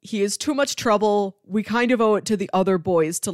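ordinary talk, nothing marked

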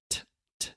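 t, t as breathy unvoiced pops; aspirated T. Shown as a demonstration. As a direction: pop each t with a puff of air. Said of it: "breathing air out of my mouth and going t".

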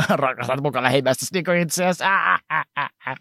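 sneering, laughing mockery; mock him with a scornful laugh; mocking jeer. Jesus could have mocked the rich man, sneering and laughing. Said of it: "olisi voinut sanoa silleen".

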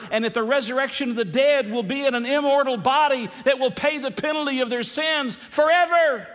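high, forceful pulpit cry; fervent warning. Real, not acted. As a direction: build to a ringing last word. Preacher warns of eternal punishment.

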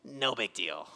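low, soft, careless throwaway; mock dismissive shrug. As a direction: toss it off like it means nothing. Mocking the Nicolaitans' attitude.

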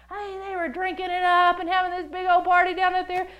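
hammed-up beer-party shout; rowdy partygoer. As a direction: whoop it out over a party.

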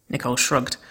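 usual speaking voice

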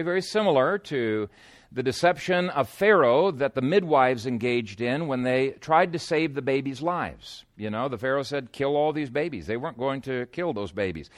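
ordinary delivery

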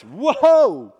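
loud, high, drawn-back whoa; recoiling whoa. Retold or imitated. Adam turning on the woman, alarmed whoa. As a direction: back away from her in alarm. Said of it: "Adam has changed his tune".

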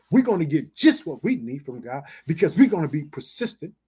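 normal talking voice

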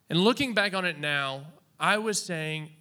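usual speaking voice

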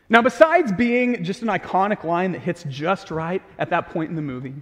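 normal talking voice

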